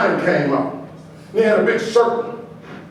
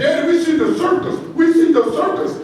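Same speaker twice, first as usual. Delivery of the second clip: put-on higher child voice pleading; begging kids; 7 semitones higher than usual. Sons to their father leaving SeaWorld, childlike begging. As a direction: play the little boys nagging daddy.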